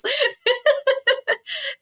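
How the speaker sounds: a high, real giggling laugh. Real, not acted. Retelling the mix-up to the host, giggling for real.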